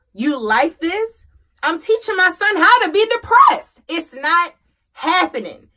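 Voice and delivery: loud, high, emphatic outburst; indignant self-scolding. Retold or imitated. Mom jolted awake by her son's words, indignant. Retells scolding herself into getting up.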